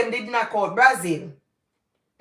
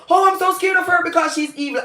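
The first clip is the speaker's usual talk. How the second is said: high, quick mimicry of frightened listeners; mock scared listener; 9 semitones higher, faster than usual. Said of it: "they say".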